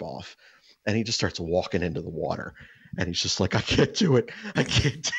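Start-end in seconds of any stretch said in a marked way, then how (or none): 3.5-5.1 pained. Retold or imitated. Father overwhelmed by hot sauce.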